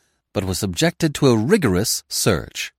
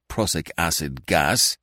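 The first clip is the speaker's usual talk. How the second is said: a touch of bitterness, slow; bitter; 4 semitones lower, slower than usual. A narrator's reading. Detective to the Commissioner, slow, lightly bitter. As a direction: let the words fall slow and bitter. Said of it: "he said bitterly".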